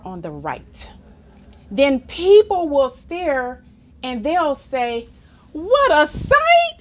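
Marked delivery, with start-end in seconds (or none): 5.5-6.8 high, loud gasp of wonder; a big wide-eyed "What a sight!"; amazed exclamation. Acted. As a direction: cry it out like a dazzled crowd. Crowd exclaiming in the story.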